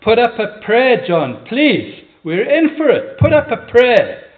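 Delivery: loud, high-pitched panicked plea; terrified shout. Acted. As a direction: shout for help as if in mortal danger. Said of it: "the one shouted to the other".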